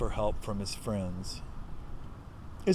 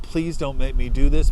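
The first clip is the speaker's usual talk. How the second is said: raised, begging plea to God; first plea, already louder; pleading with God; 8 dB louder than usual. Retold or imitated. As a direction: beg God to spare him, voice rising. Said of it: "He's crying. He's asking God".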